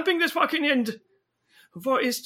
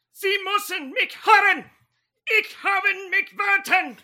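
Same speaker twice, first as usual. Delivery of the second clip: yelling in German; 7 semitones higher than usual. Acted. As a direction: yell it in German. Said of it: "Don't yell in German".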